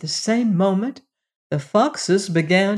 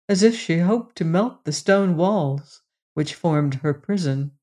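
ordinary delivery, nothing marked

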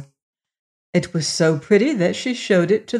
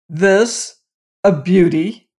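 louder, outraged king's exclamation; indignant cry; 5 dB louder, slower than usual. A narrator's reading. King confronted with the ugly bride, indignant cry. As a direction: cry it out in scornful disbelief. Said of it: "he cried".

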